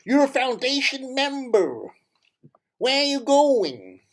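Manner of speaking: put-on American-South African accent, drawn out. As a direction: put on the rabbi's American twang. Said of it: "American accent ... South African accent".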